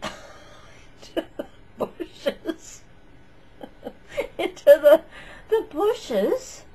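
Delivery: words broken by high giggles; laughing while telling it. Real, not acted. Vlogger tells viewers she jumped into bushes, laughing. Laughing at her own jump.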